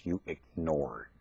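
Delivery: ordinary talk, nothing marked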